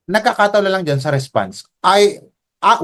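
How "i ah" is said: ordinary delivery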